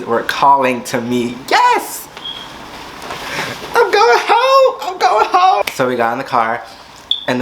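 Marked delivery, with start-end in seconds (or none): 1.5-5.6 joyful shout. Retold or imitated. Finding the ride at last.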